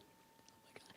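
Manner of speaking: whispered oh my God; awed whisper. Real, not acted. Speaker stunned by the widower's grief, whispering. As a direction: breathe it out, stunned.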